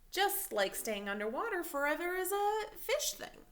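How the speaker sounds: a dad explaining gently but firmly to his son; a hint of a patient father reasoning with his son.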